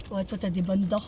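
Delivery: normal talking voice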